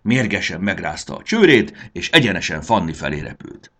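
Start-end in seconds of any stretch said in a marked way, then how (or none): none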